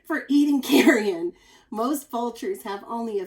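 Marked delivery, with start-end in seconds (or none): 0.1-1.3 laughing through the words, much louder; giggling at gross part. Real, not acted. Reading to kids, laughing at the gross part. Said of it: "this is kind of a gross part".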